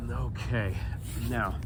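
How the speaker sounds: ordinary talk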